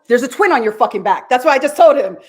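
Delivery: excited. Real, not acted. Excited revelation to audience.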